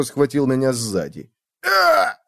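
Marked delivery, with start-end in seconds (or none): 1.6-2.2 sharp high cry of pain; pained yelp. Acted. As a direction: yelp from a kick. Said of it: "Мне зарычали в ухо". (вскрик от боли)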